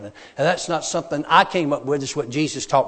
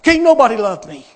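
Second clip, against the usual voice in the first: high, loud burst; imitating a man shouting back in despair; anguished retort; 7 semitones higher, 9 dB louder than usual. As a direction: cut in with a wounded protest. Robert's angry retort to kindness.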